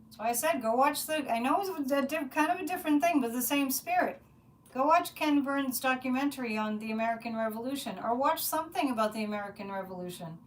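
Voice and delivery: ordinary delivery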